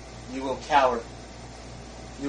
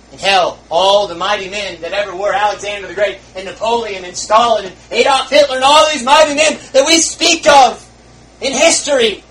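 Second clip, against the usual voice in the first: rising louder and higher through the list; building shout; 6 semitones higher, 11 dB louder than usual. Real, not acted. Preacher building to a shout over the mighty men. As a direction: climb to a roar name by name.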